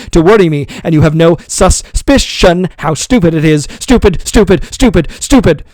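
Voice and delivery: furious tirade. A narrator's reading. Master ranting at servant over wages, furious loud. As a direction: hammer the word stupid home.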